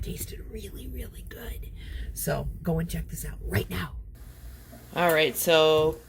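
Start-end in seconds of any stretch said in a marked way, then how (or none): 0.0-1.7 breathy whisper of delight, softer. Real, not acted. To viewers about the dish, a delighted whisper. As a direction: whisper it like a secret treat.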